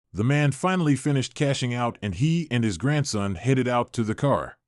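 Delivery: ordinary delivery, nothing marked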